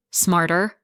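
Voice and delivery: a bitter, biting echo of his word; sharp and bitter. A narrator's reading. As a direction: throw the word back at him. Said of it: "sharp and bitter".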